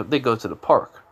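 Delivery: ordinary delivery, nothing marked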